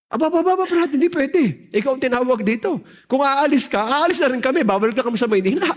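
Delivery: mataas at mariing pagtanggi, ginagaya ang mga kasama; pagtutol. Retold or imitated. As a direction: gayahin ang nabiglang pagkontra ng mga kasama. Members object to his leaving. (mataas ang boses na tumututol)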